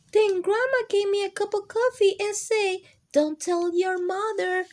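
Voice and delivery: high, bright-eyed read-aloud; excited storytelling. A narrator's reading. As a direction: lift it up like a surprise twist.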